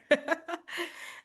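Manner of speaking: rising giggle at own joke; high laugh. Real, not acted.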